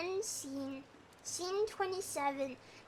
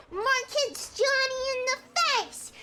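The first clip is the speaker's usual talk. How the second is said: ordinary talk, nothing marked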